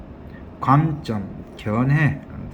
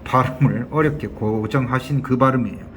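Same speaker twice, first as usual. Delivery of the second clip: ordinary talk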